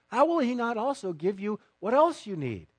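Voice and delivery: ordinary talk, nothing marked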